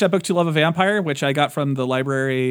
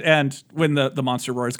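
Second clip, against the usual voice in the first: ordinary talk, nothing marked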